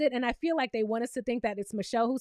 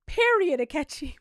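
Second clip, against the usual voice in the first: hype cheer. Real, not acted. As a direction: cheer then settle. Cheering Akechi again.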